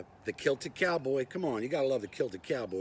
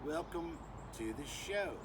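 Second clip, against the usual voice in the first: fake Australian accent; 4 dB quieter than usual. [name] introducing himself to YouTube, fake Australian accent. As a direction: put on a bad Australian accent. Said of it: "with my fake Australian accent".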